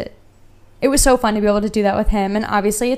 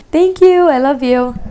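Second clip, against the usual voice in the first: calling out to pet; 5 semitones higher, 7 dB louder than usual. Real, not acted. Pet tiptoeing past during recording.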